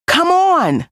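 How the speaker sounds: high, excited squeal of a cub; eager call. A narrator's reading. Simba urging Nala to come exploring, excited. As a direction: pull your friend along, thrilled.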